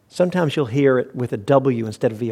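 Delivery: usual speaking voice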